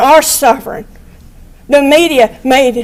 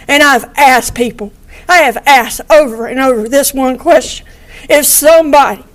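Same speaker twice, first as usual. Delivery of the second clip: usual speaking voice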